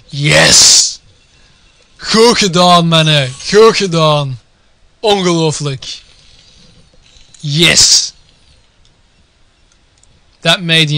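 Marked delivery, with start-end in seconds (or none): 0.1-8.1 happy cheer. Real, not acted. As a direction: cheer the players on. Cheering the players in Dutch.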